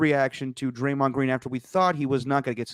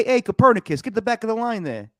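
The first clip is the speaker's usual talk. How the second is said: mock heckle; 9 semitones higher than usual. Acting a stadium heckle.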